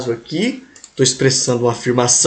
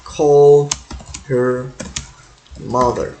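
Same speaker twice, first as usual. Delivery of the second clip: slow dictation; much slower than usual. Shown as a demonstration. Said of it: "né".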